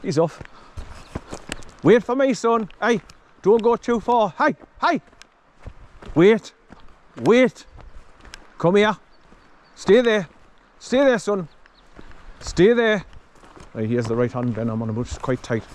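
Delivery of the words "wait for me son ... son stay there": high, loud calls after a running dog; calling the dog. Real, not acted. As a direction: shout after the dog to stop him. Calling his dog back.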